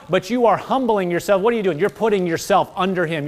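ordinary talk

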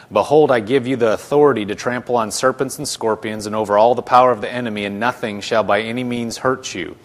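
loud, emphatic Bible reading; scripture reading. Acted. Preacher reading the passage to his class, emphatic scripture reading. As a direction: read the verse out with firm emphasis. Reads scripture aloud to group.

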